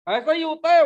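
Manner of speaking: emphatic repeat. Real, not acted. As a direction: hammer ऐसा ही होता है home to the congregation. Preacher repeats the phrase for emphasis.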